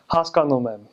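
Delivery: Haskanumem with a full "ah" in the second syllable; even syllables: "has-kahn-oo-mem"; good Armenian accent. Shown as a demonstration. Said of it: "a good accent would be".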